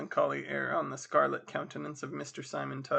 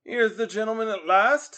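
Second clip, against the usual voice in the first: high, loud street tout; mock-polite coachman; 7 semitones higher, 8 dB louder than usual. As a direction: hail him with exaggerated courtesy. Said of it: "touching his hat with mock politeness".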